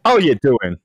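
put-on Australian accent, breezy hello. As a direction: toss it off like a cheery Australian. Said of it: "Australian with an Australian accent".

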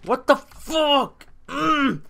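high, loud, drawn-out scream of rage; raging scream. Real, not acted. Gamer losing it at a failed jump, raging scream. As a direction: scream it slowly, then strain through a high grunt.

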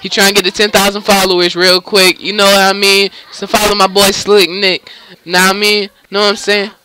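loud promo shoutout for a friend; hype shoutout. Acted. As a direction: plug it like a hype ad.

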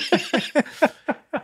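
a high laugh at his own nerves. Real, not acted. Remembering sweating at the table read, a high laugh. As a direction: laugh at how nervous he was.

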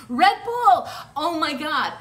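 loud, high burst of sudden realisation; excited exclamation. Real, not acted. Card reader suddenly hitting on Red Bull, excited shout. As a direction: blurt it out loud, thrilled.

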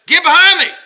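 sudden high, loud shout; explosive rebuke; angry rebuke. Acted. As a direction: bark it out as a furious command. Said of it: "that's strong".

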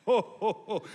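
Santa-style ho ho ho laugh at his own joke; hearty laugh. Real, not acted. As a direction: let out a big jolly laugh.

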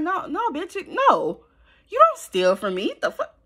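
high, indignant outburst; real anger. Real, not acted. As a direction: snap back at him, outraged. Furious at boyfriend stealing money.

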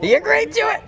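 high, loud thrilled cry; excited shout. Real, not acted. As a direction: blurt it out, thrilled. Meter spikes after asking spirit.

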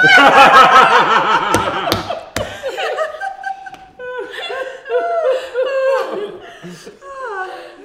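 long shrieking laugh; loud high laughter. Real, not acted. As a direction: howl with laughter. Group laughing.

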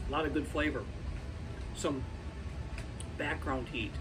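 talking with a mouth full; words round a bite of pickle; cheeks stuffed full. Real, not acted. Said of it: "Sorry to be talking with my mouth full".

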